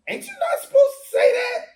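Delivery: high, loud laughing squeal; squealing, giggling mock Brit; mocking British guy. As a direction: ham up a shocked British comic through laughter. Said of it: "The British guy is going".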